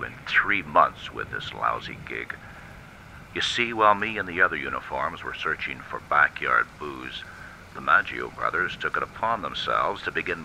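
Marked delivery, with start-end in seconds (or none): none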